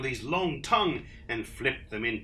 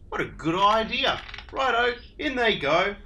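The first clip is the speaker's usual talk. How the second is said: high, louder cheery dingo; bright eager agreement; cheerful Dingo; 9 semitones higher, 7 dB louder than usual. Acted. Dingo agrees with the others, cheery and loud.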